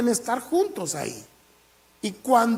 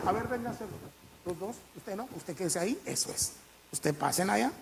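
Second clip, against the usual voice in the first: king quietly directing the men, much softer; hushed orders; 2 semitones lower, 14 dB quieter, faster than usual. Acted. King quietly ordering his men aside.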